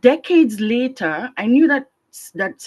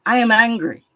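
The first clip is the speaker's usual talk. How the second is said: "I am angry" said loud and firm, stress on "I"; declared out loud; 3 semitones higher, 6 dB louder than usual. Real, not acted. Declaring her anger openly to the group, loud. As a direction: let it out at full voice.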